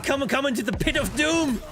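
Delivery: mock-villain invite into the pit; gleeful taunt. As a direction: lure him in with glee. Taunting the enemy in game.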